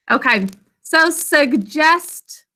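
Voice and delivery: slow, clear teacher model of suggest; slow model word. Shown as a demonstration. Teacher to online class, modelling the word slowly. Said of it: "say it with me".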